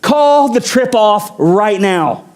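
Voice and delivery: impassioned, high-pitched plea to God; Moses. Acted. Praying to God about his presence on the journey, impassioned plea. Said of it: "And Moses said".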